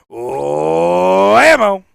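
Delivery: huge drawn-out "Whammo" that swells on the first sound; hammed-up shout. Host answers co-host's question about Whammo, hammed-up long shout. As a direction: bellow "Whammo" like a hype slogan.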